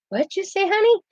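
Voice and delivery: put-on a light Texan accent, pitched high on honey; a light hint of the Texan singer asking sweetly; Texan drawl. Texan country singer asking the little girl her name, lightly Texan. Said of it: "I know [name] is Texan".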